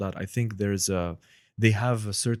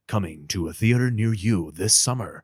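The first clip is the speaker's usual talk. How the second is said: movie trailer voice; 4 dB louder than usual. Shown as a demonstration. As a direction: sell it like an epic movie trailer. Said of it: "which is a movie trailer voice".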